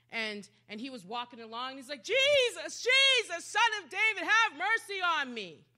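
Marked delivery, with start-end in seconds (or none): 2.0-5.7 high, loud desperate cry for mercy; yelling. Acted. Blind man begging Jesus for mercy, yelling high and loud. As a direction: cry out to be heard over a crowd. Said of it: "He's yelling".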